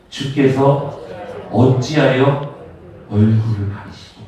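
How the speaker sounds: low, slow, solemn verse reading; scripture read-aloud. Acted. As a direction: read it in short phrases with pauses, dropping low at the end. Leads congregation reading verse aloud. (낮고 느리게 끊어 읽는 성경 낭독)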